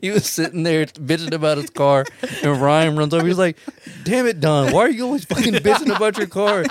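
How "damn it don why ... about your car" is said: 1: mock scolding. Ryan scolding Don, retold.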